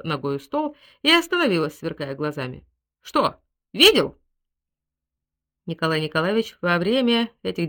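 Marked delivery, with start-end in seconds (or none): 3.0-4.2 loud, slow, higher-pitched taunt; punchy, provoking question; defiant challenge. A narrator's reading. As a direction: throw it at him triumphantly. Woman challenges him after smashing things.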